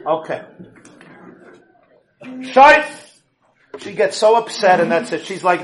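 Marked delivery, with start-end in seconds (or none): 2.4-2.8 exclamation. Real, not acted.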